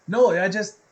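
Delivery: usual speaking voice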